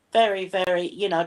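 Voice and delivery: ordinary talk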